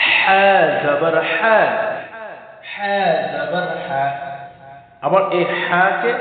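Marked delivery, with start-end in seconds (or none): 0.1-4.0 slow chant, হা as a strong pharyngeal "h"; drawn-out throat হা for repetition; throaty ha drill. Shown as a demonstration. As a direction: stretch হা জবর হা from the throat. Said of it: "হা হায়ে সত্যি".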